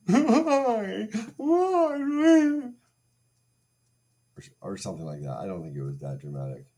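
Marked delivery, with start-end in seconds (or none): 0.1-2.7 high, loud, hammed-up wail of why me; mock sobbing plea. Ex breaking up with him, mock sobbing and begging. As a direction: overplay a begging sob, far higher. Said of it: "sobbing and begging".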